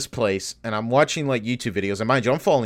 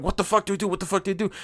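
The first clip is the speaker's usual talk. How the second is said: panicked thinking; 6 semitones higher, 4 dB quieter, much faster than usual. Retold or imitated. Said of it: "thinking, like".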